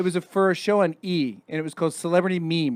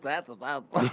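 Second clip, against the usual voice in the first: lower, softer put-on mimicking voice; mimicked mom impressionist; 4 semitones lower, 8 dB quieter than usual. Joking about her copying people, low imitating voice. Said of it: "She'd be like".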